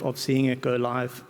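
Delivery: ordinary talk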